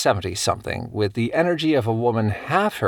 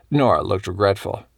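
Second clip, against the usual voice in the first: ordinary delivery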